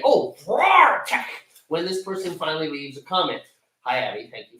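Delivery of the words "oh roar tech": slow, drawn-out "Oh, roar tech" in a put-on voice; mock reading aloud. Imitating himself reading a comment from across the room. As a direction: ham up squinting at the screen. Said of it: "walk up to the computer and say".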